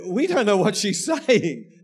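normal talking voice

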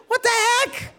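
very high-pitched comic squawk; alarmed disbelief. Retold or imitated. Pastor seeing police surround his house, alarmed disbelief. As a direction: yelp it in mock bewilderment.

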